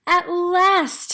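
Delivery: a relieved, proud at last. A narrator's reading. Big penguin greeting his new chick, proud and loud. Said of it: "looking proudly down".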